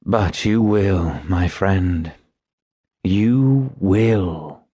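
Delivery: slow, low, sorrowful insistence; pressing it home sadly, gently. A narrator's reading. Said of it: "shook his bald head sadly and said".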